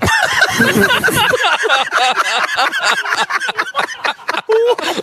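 long high burst of laughter; big laugh. Real, not acted. Laughing hard at his own Massimo curry pun. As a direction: crack up at your own pun.

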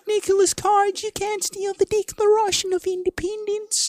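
high, slow falsetto woman; mock woman's voice. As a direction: whine it like a pampered heroine. Mocking female character.

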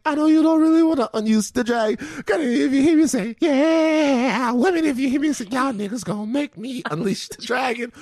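high-pitched mock-threatening R&B hype; hammed-up hype-man warning. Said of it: "but that's [name]".

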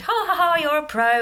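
retold jovial ribbing; jokey banter. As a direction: imitate someone's light teasing laugh. Retelling sonographer's jovial banter.

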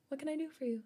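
soft, high timid service voice; meek polite cashier asking; customer service voice. Shown as a demonstration. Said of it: "mine is so, so timid".

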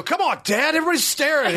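high, whiny-son mock yell; mock screaming. Playing Frank Junior to his dad at karaoke, mock scream. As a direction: plead with Dad in a hammed-up yell. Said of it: "I was screaming".

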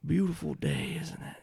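a sweet-talking charmer greeting strangers; genteel stranger voice. Acted. GM stranger replies.

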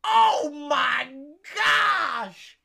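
loud, high mock wail; retold anguished wail. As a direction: cry out as the man hearing the spoiler from a stall. Man in stall hears spoiler.

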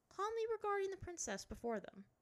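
plain reading voice

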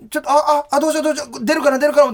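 high, stammered mock panic; retold jitters. Comedian reliving nerves before his entrance, mock panic. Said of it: "不安ももちろんあったんやけど".

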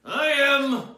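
big showman host intro; announcer voice. Acted. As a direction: belt it out like a TV host.

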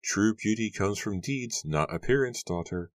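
mildly scolding mother. A narrator's reading. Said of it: "scolded her with the same words".

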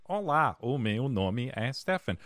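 stiff robotic Portuguese. Retold or imitated. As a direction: recite it like a robot. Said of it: "I sounded like a robot".

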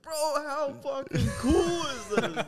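very high, loud, drawn-out gushing; mock awestruck excitement. [name] gushes to his fed-up friend, mock excitement. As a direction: imitate an overexcited friend losing it with delight. Imitates thrilled friend amid street chaos.

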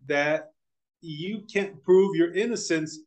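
usual speaking voice

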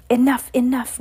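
calm her down firmly; firm repeated command. A narrator's reading. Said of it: "Winifred repeated".

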